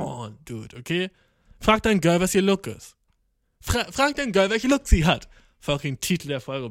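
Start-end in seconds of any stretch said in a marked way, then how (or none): 1.6-5.3 emphatic. Real, not acted. Lecturing boys, episode title.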